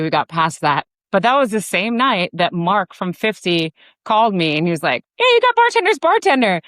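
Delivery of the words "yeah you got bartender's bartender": high, loud mock congratulatory call; excited announcement. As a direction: imitate a caller breaking great news. Acted phone call with award news.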